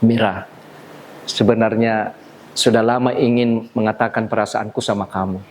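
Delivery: suara rendah, tersendat oleh jeda; pengakuan cinta wajar. Shown as a demonstration. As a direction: ungkapkan perasaan secara pribadi, bukan untuk penonton. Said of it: "wajar di luar panggung mengatakan begini". (gaya sehari-hari yang rendah dan ragu-ragu)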